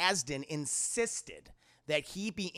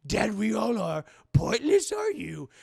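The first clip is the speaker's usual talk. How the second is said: Yoda scolding, slow and getting louder; 4 semitones higher, 5 dB louder than usual. Retold or imitated. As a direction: scold him bitterly.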